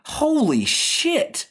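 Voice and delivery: high, drawn-out astonished exclaim; stunned surprise. Real, not acted. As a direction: let the shock stretch the words out. Shocked show ran since 2007.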